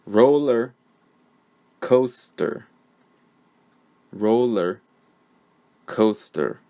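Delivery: "Roller", "coaster" as separate words, first syllables stressed, long pauses between; careful word model. Shown as a demonstration. Teacher saying the words so learners find the schwa, careful dictation. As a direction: dictate RO-luh(r), COA-stuh(r) one word at a time. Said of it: "I'll say the words".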